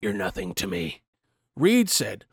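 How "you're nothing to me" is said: cold low mock Biden. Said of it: "Biden continued by saying".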